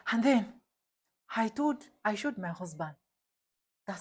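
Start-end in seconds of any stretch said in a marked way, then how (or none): none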